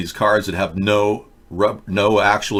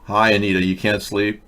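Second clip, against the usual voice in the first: ordinary talk, nothing marked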